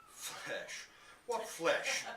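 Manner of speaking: shocked, disbelieving question with a laugh; alarmed repeat of the word, half laughing; startled repeat. A narrator's reading. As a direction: voice jumps up in alarm. Startled man repeats boy's word.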